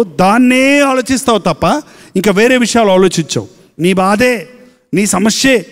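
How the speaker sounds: ordinary delivery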